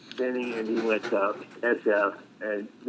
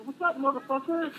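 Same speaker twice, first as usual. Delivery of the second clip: pitched way up, cocky hello; mock tough greeting; 13 semitones higher than usual. Patient greeting the PA he fooled, high cocky hello. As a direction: razz the old buddy with a high cocky greeting.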